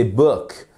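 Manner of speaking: "book" with a short lax vowel from the throat; English "book", short back vowel; correct English vowel. Shown as a demonstration. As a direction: say "book" short and relaxed, not with the lips. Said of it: "c'est avec la gorge".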